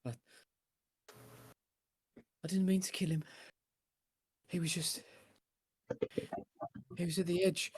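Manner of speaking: put-on breathless high whisper of a broken boy; frightened whisper. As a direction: confess it barely aloud, terrified. GM voices the shaken squire.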